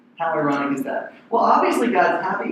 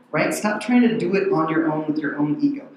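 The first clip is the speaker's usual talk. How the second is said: ordinary talk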